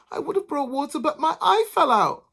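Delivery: high, pleading excuse; plaintive bride. A narrator's reading. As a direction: plead with her.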